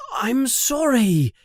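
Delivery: usual speaking voice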